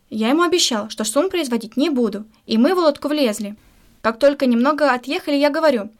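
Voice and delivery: usual speaking voice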